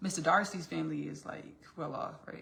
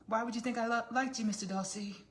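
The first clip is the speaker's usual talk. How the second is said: higher, haughty period-drama heroine; Elizabeth; 6 semitones higher than usual. Acted. Elizabeth denies liking Mister Darcy, mock haughty. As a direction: play a mock costume-drama denial. Acts out Elizabeth's denial of love.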